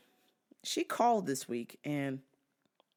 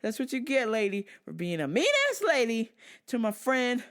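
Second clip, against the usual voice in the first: louder mock gloating; 6 semitones higher, 6 dB louder than usual. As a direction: rub it in, loud and smug. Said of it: "I was like".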